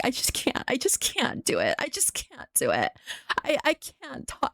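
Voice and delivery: soft, breathy imitation of a tearful youtuber; mock tearful whisper. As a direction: hush it like you're about to cry. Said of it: "she starts crying".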